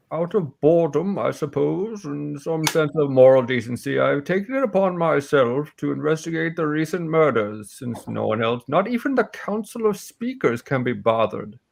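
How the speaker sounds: put-on jaded old warrior explaining her investigation; aging warrior, scornful of idle authorities; old warrior woman. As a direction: play a world-weary warrior taking charge. Game master voices Hilin.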